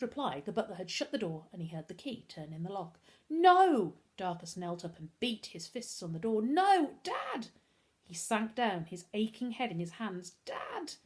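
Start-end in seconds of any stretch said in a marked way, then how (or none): 3.3-4.0 high, loud cry of protest; anguished yell, high and loud; desperate shout. A narrator's reading. Locked out, beating on the door. 6.4-7.5 high cry, loud then thinning; anguished, climbing very high; desperate cry. A narrator's reading. Beating fists on the door. 10.4-10.9 high, soft sobbed cry; thin, high-pitched sob; sobbing. A narrator's reading. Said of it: "he sobbed".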